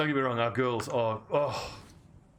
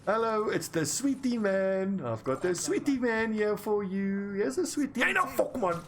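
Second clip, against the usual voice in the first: put-on high-pitched, drawn-out Afrikaans-accented sing-song, jumping higher at the end; Wikus van Heerden; 10 semitones higher than usual. Quoting a favourite District Nine scene, high Afrikaans-accented impression. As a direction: put on a high Afrikaans movie-character voice, stretching the words. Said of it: "Wikus van Heerden out of District Nine".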